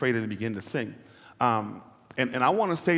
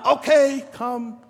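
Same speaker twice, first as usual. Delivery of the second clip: Jesus answers; 11 semitones higher, 7 dB louder, slower than usual. Acted.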